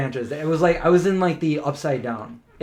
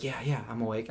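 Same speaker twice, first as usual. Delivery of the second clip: much softer, low hushed answer; hushed reply; 4 semitones lower, 13 dB quieter, faster than usual. Retold or imitated. Partner answering from the dark tent, hushed. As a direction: keep it down, a bear is outside. Whispered exchange in tent, bear outside.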